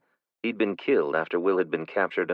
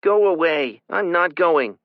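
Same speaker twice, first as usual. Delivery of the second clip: put-on high, loud defiant child; child yelling; 11 semitones higher, 7 dB louder, slower than usual. A narrator's reading. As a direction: shout back, refusing to come out. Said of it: "Kylie yelled".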